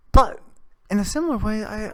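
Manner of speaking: usual speaking voice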